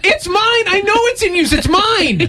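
high, whiny cry of mine; possessive whining. Acted. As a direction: whine like a child clutching a toy. Claiming the taken email address.

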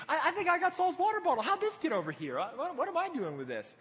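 high, rushed mock surprise. David shows off the king's stolen bottle, mocking. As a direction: feign innocent bewilderment to taunt him. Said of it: "he's just mocking him".

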